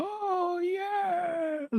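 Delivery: very high, crying squeal of a creepy manager; pallbearer cry. Retold or imitated. Podcaster impersonating the Undertaker's pallbearer manager, crying high squeal. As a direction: shriek it out like a wailing old manager. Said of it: "Odeon's pallbearer".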